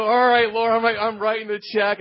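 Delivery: a loud, grudging yes to the Lord; loud resigned surrender. Retold or imitated. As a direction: throw up your hands out loud. Giving in to God's request.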